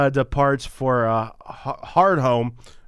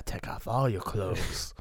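soft seductive voice; 4 semitones lower, 10 dB quieter than usual. Retold or imitated. Seductive whispered line.

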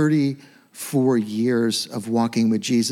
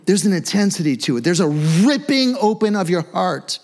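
ordinary talk, nothing marked

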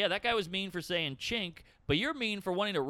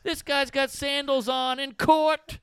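high, loud, drawn-out disbelief; slow incredulous stand-up shout; Sebastian Maniscalco; 11 semitones higher, 8 dB louder, slower than usual. Acted. Comic on stage spotting sandals in court, appalled impression. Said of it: "you get Sebastian Maniscalco up there going".

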